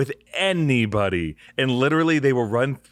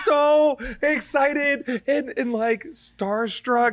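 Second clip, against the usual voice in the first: giddy; 12 semitones higher, much slower than usual. Real, not acted. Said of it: "describing own excitement".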